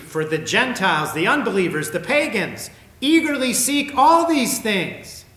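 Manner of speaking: loud, rising preacher's cry; forceful preaching. Acted. Preaching scripture at full force.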